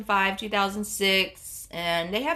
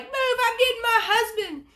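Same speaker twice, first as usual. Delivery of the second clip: very high mock-frantic cry; hammed-up urgency, pitched way up; imitated frantic sister; 13 semitones higher than usual. Sister rushing to get her husband, imitated franticness.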